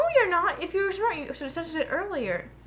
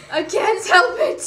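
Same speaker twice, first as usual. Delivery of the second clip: laughing protest; 7 semitones higher, 8 dB louder, slower than usual. Real, not acted. Laughing reply to scolding.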